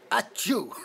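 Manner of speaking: loud fake sneeze; mock sneeze. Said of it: "you say the same".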